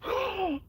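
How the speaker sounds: high mocking gasp; gasping back at critics. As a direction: throw their gasp right back. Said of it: "I look right back at you and go".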